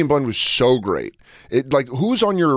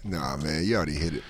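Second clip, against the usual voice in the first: low quiet guy voice; 7 semitones lower, 10 dB quieter than usual. Retold or imitated.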